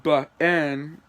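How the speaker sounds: "B-N" said slowly in two parts, buh, en; split pronunciation. Shown as a demonstration. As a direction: sound out "B-N" as buh-EN. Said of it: "how to say them".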